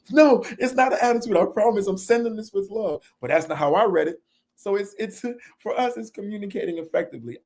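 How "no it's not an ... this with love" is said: higher, pleading mock protest; protesting innocence. Defending a text to an upset partner, higher and pleading. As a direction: insist it was sent with love.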